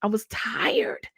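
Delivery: softly whispered, slow and worn out; slow exhausted whisper; tired whisper. Real, not acted. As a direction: breathe it out slowly, exhausted. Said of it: "weary whisper recalling breakdown".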